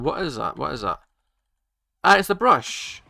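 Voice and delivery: ordinary delivery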